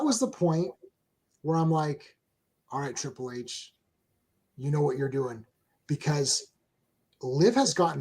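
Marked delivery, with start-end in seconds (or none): 2.7-5.4 low, hushed aside of approval; retold private thought, softly voiced; quiet approval. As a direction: give a nod of respect under your breath.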